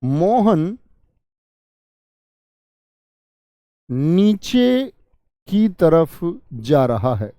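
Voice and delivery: very slow, word by word dictation; dictating slowly. Shown as a demonstration. Teacher writing a sentence for students, very slow. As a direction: draw out each word while writing it on the board. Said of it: "मैंने लिखा".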